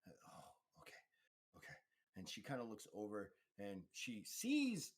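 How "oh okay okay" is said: faint, breath-only murmur; groggy acceptance. Acted. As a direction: answer weakly, still coming round. Groggy patient takes in where she is.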